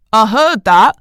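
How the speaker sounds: loud distant indignant call; indignant call from afar. A narrator's reading. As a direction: shout it from the next room. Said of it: "called an indignant voice from the other room".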